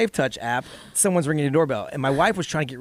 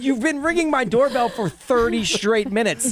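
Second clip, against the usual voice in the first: high-pitched exasperated retort; indignant complaint; 7 semitones higher than usual. Retold or imitated. Homeowner telling off the man at his door, exasperated. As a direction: re-enact an outraged telling-off. Acted doorbell quarrel.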